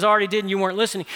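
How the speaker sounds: rushed retort. Retold or imitated. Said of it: "And he says".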